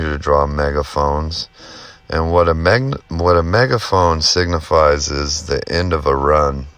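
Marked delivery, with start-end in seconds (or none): none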